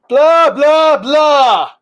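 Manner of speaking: mock blah blah. Mocking what kids are taught.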